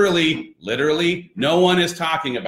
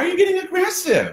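much higher, fast mock outrage; high mock alarm; faster than usual. Dad teasing his toddler about being aggressive, high squeaky mock alarm. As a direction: squeak it out in playful shock.

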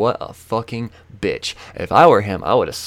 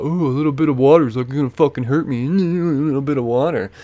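higher-pitched mocking imitation of bravado; mock super-cool; 5 semitones higher, 4 dB louder than usual. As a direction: mimic him acting unbothered by water. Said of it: "he's trying to be super cool about it".

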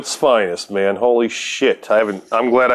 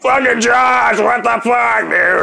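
excited shout; 8 semitones higher, slower than usual. Real, not acted. Greeting old friend on call.

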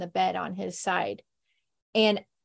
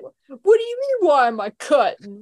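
hammed-up Bill Murray; 4 semitones higher, 5 dB louder than usual. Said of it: "He's like".

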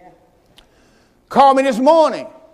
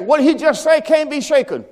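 ordinary delivery

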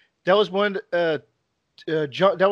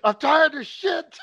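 mock frustrated yell; 7 semitones higher, slower than usual. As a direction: drag it out like you've had enough. Mocking John's frustration.

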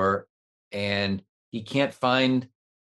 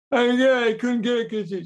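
put-on high, loud kid voice, mushy words; kid with speech impediment; 11 semitones higher, 5 dB louder than usual. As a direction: stammer out the excuse like the kid. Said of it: "the kid has a speech impediment".

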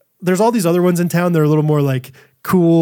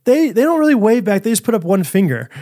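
mock little girl voice; 6 semitones higher than usual. Dad voicing young daughter.

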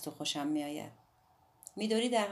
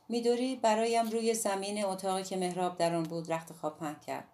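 usual speaking voice

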